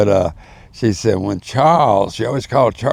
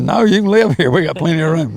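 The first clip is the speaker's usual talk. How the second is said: high, eager offer, a touch louder; Hazel insisting; 7 semitones higher, 4 dB louder, faster than usual. Retold or imitated. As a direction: sing it up high to coax her in. Hazel insisting LaRue move in.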